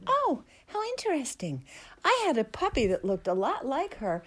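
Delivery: high, coy fake-casual voice; feigned nonchalance. Replying to Audrey, high and coyly casual. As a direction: pretend you're barely interested. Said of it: "So I say".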